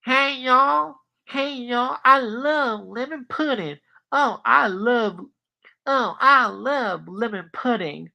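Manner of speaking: put-on high, drawling southern country accent; hammed-up high southern twang with stretched vowels; Stinky. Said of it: "he has a southern accent".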